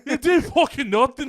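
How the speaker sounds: high, loud laughing taunt; hammed-up trash talk; mock fighter taunt. Repeating the fighter's taunt, high laughing trash talk.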